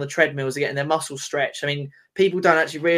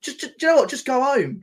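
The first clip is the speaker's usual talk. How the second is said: exasperated; 10 semitones higher than usual. Real, not acted. Fan ranting at manager's choices.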